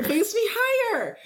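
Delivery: very high squeal, laughing; squealing kid voice. Retold or imitated. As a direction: squeak it like a tiny thrilled kid.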